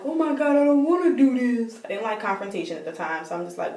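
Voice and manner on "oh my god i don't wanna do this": high-pitched, dramatized dread; retold whining protest. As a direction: whine it like a reluctant kid. Upset, protesting being taken there.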